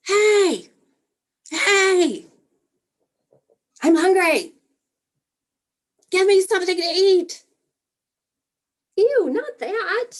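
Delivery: put-on a parent waking the grown kid, baby-style; baby's cries. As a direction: yell like a fussy baby waking you up. Parent imitating a crying baby again.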